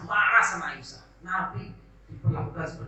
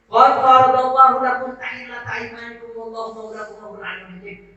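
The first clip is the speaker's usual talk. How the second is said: Quran recitation; 9 semitones higher, 10 dB louder than usual. Acted. Preacher reciting the Quran verse to the congregation, chanted.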